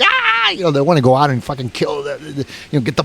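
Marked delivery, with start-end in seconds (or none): 0.0-0.5 high, drawn-out "aah" imitating frenzied dogs; crazed dog scream. Hunter mimicking dogs that look like they're on PCP, crazed scream. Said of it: "They're like".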